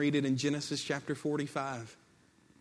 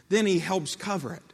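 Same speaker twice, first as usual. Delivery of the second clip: normal talking voice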